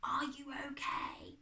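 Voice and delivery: slow, mouthed whisper; worried whisper. A narrator's reading. Hidden girl checking on her friend, worried whisper. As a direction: whisper it slowly with concern. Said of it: "looking worried".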